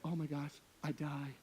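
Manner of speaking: soft, low mock swoon; hushed imitation of being overcome; imitated wife's gushing. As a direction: murmur it quietly as a pretend swoon. Imitates wife gushing over something cute.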